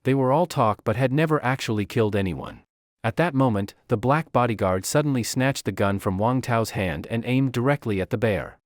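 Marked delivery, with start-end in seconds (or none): none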